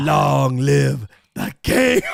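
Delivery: a very slow, drawn-out shout of a line; slow mock royal shout. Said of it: "he's like".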